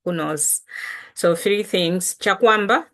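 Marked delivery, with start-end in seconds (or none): none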